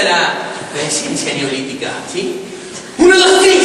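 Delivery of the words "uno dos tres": shouted count to three, high; loud count. Acted. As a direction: call the count out with force. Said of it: "yo cuento a tres".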